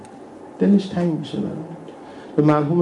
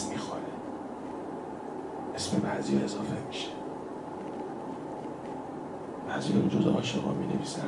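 very soft, half-whispered solemn telling; hushed preaching; 13 dB quieter than usual. Real, not acted. Preacher to congregation about tonight's list of names, hushed. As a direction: let the voice sink almost to a whisper.

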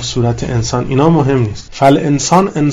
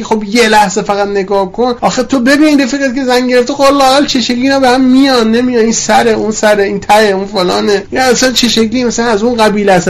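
coaxing, higher voice of the nagging self; playful tempter voice, pitched up; voice of temptation; 5 semitones higher than usual. Retold or imitated. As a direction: wheedle like the ego talking you into a look. Voicing the tempting self's whispers.

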